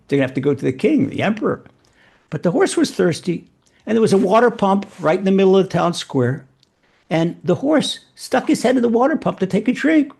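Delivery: normal talking voice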